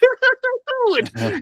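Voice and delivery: very high, wild cackling laugh in character; shrieky hillbilly cackle. Acted. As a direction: let out a crazy backwoods hoot of a laugh. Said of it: "I became Backwoods Buttercup".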